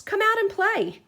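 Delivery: high squeaky mouse calling out; a tiny mouse calling to a friend. A narrator's reading. Mouse calls Bear out of the trees, high. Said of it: "called Mouse".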